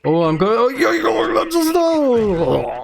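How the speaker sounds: high, stretched-out yo yo; drawn-out stunned exclamation; excited disbelief. Real, not acted. Car flies out of control.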